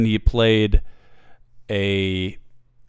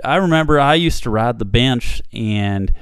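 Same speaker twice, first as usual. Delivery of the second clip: raised, loud lisping Southern voice; 4 dB louder than usual. Retold or imitated. Host imitating the doctor recalling football days, Southern lisp. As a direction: mimic a feminine Southern man with a lisp. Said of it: "very feminine voice, uh, a lisp, and southern".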